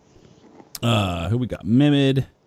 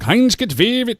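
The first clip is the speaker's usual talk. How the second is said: high, loud-edged gibberish German; comic German accent; mock German; 3 dB louder than usual. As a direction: parody a German name in a high voice. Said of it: "Straight up German. It's more like".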